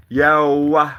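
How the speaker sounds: a big loud greeting. Acted. Greeting the chat viewer, a loud call.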